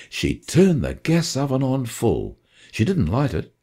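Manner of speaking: ordinary delivery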